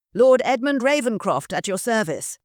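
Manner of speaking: lightly voiced refined gentleman; polished stranger asking politely; cultured gentleman. Lord introduces himself.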